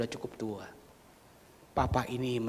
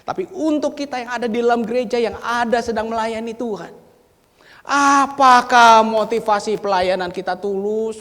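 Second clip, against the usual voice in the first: loud, high-pitched, fast preaching; forceful preaching; 10 semitones higher, 15 dB louder than usual. Acted. Preacher loudly challenging the church workers. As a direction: challenge them directly.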